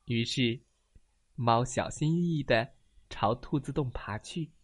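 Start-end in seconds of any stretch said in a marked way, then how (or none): none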